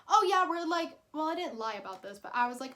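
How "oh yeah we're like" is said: higher, louder forced cheer; nervous lie. Retold or imitated. Lying to dad about where she is, higher and louder. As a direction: fake an easy answer. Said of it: "I also lied saying".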